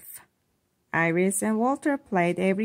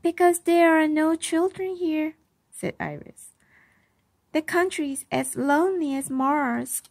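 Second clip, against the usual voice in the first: put-on higher girl's voice, a touch sad; high, mildly glum child; lonely little girl; 8 semitones higher than usual. A narrator's reading. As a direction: explain her loneliness like a child. Narrator voicing Iris, lonely.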